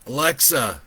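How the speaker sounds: shouting, restrained; mild shout. A narrator's reading. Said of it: "he shouted to his A I".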